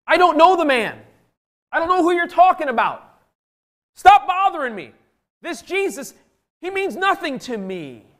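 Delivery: high, agitated denial; exasperated outburst, disowning a friend; Peter. Acted. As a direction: protest heatedly, pitch far up and falling at each phrase end. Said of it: "how about Peter?".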